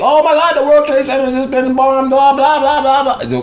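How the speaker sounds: very high, loud panicked news voice; frantic newscaster. Retold or imitated. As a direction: mimic a reporter freaking out live. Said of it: "then the news is like".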